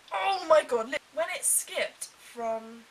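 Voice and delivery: loud burst of disbelief; exasperated outburst. Real, not acted. Fan ranting about the missing funeral, loud exasperated burst. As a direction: throw it out, fed up.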